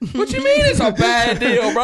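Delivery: high, loud incredulous comeback; alarmed disbelief. Retold or imitated. Freaked-out passenger challenging his friend's weather verdict, loud disbelief. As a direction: yell it back in comic panic. Retold panicked reply to friend.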